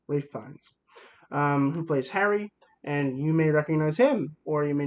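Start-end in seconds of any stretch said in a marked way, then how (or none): 0.1-0.7 "Ralph Fiennes" softly repeated as RAFE FINES; "Ralph" rhymes with safe, "Fiennes" with lines; corrected name pronunciation. Shown as a demonstration. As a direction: say "Ralph Fiennes" as rayf fynz, softer. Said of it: "but it's not".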